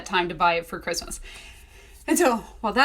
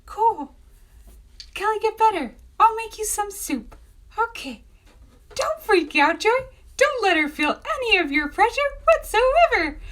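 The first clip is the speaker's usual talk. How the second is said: very high, strained mock cheerfulness; barely contained panic; 11 semitones higher, 3 dB louder than usual. Author wishing her sick editor better, squeaky forced calm. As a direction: squeak out sweetness while trying not to freak out.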